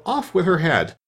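high-pitched mild shout; understated queenly shout. A narrator's reading. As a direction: pitch the order up, only half shouted. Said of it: "and shouting".

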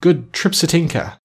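high, slow grateful cry; joyful thanks. Acted. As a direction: pour out heartfelt thanks. Prince thanks fairy joyfully.

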